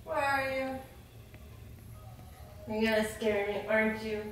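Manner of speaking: very soft, cautious call; hushed, nervous searching voice; wary searching. Real, not acted. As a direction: creep along expecting a jump scare. Searching for someone hiding to scare.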